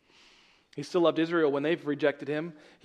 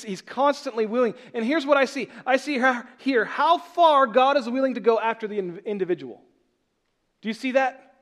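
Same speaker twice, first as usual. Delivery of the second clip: loud, raised preaching, slowing on key lines; preaching at full force; 6 semitones higher, 7 dB louder than usual. Acted. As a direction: hammer home that God's love never changes. Preacher drives point home.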